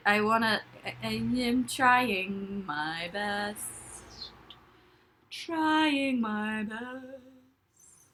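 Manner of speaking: ordinary talk, nothing marked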